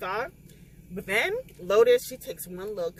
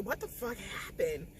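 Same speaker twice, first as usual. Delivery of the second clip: whispered confusion; 12 dB quieter than usual. Retold or imitated. Waking man confused.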